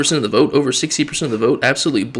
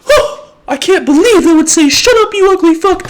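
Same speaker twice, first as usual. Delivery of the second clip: much higher, louder falsetto with laugh; mock outrage; 7 dB louder than usual. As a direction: clutch the pearls in a squeaky voice. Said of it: "And everybody".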